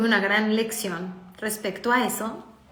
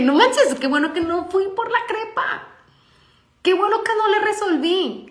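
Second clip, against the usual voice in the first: loud, high, exclaimed relief; retold astonishment, pitched right up; amazed relief; 8 semitones higher, 3 dB louder than usual. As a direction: burst out as if narrowly escaping a mistake. Retells her amazed relief at reaction.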